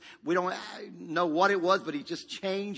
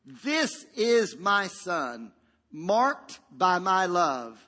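very slow, stretched proclamation; solemn, deliberate voice of God; voice from the cloud; 3 dB louder, much slower than usual. Acted. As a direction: draw each word out like a heavenly decree. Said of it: "from the deep cloud a voice".

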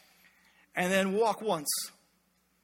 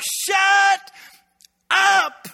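much higher, louder furious shout; parent yelling; 10 semitones higher, 8 dB louder than usual. Retold or imitated. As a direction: snap at the kids in the back seat. Parent yelling at kids in car.

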